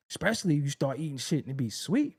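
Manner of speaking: ordinary talk, nothing marked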